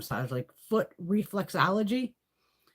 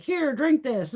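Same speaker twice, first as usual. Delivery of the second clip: mother; 8 semitones higher, 5 dB louder, slower than usual. Retold or imitated. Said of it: "be like".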